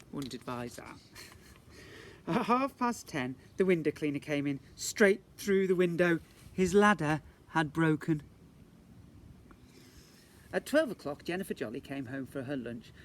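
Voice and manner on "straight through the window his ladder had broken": loud, slow emphatic telling; dramatic punchline. Acted. Storyteller hammers the window cleaner crash, loud and slow. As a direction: land the crash line big.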